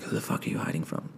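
hushed unvoiced whisper; whispered dog quote. Voicing the dog mid mic test, whispered. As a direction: whisper the dog's question. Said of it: "She's like".

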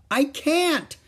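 put-on high, loud angry little girl; angry child's shout. A narrator's reading. Little girl shouting at her mother through the door, angry. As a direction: yell it back, furious and stuck. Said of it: "shouted Ramona angrily".

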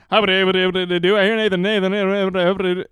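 a touch of auctioneer patter. Pretend. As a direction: call the bids like an auctioneer. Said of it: "my own, um, auctioneer voice".

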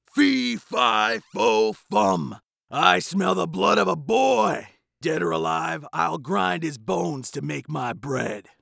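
high, loud giant's roar, slowing into a threat; shouting giant. A narrator's reading. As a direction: bellow like a furious giant. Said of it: "The giant cried".

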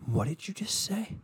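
high, slow, soft stunned question; hushed high shocked question; mock shock. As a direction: act appalled in disbelief. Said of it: "And you go".

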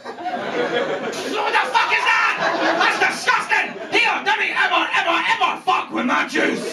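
high hammed-up Scottish outrage; high, mock-furious Scottish tirade; outraged people of Scotland. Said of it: "And the people of Scotland will".

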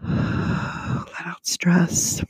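whispered slow exhale of words; soft whisper. Real, not acted. Breathing out during guided relaxation.

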